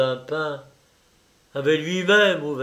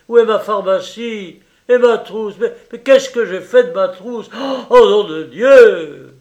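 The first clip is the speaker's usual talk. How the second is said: loud, high, exasperated shouting; angry yelling; 7 semitones higher, 12 dB louder than usual. Acted. Surgeon shouting about his missing medical bag, angry yelling. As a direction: rage at the missing kit. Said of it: "cria-t-il".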